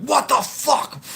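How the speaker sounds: high, drawn-out shouted outrage; startled shout. Retold or imitated. Driver yelling when a shoe hits his car, startled shout. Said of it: "I'm like".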